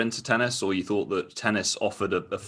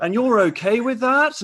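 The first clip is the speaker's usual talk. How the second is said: much higher, slow, louder disbelieving question; incredulous Louis; 5 dB louder than usual. Retold or imitated. Host voices Louis aghast at the wife's answer. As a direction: stretch out the shocked polite question. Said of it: "And he goes".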